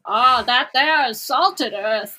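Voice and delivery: put-on old New Englander. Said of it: "Bad Hat Harry is prototypical New Englander".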